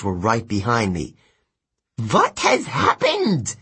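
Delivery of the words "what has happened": high, slow demon screech; screaming demon. Acted. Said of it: "One of the demons screamed".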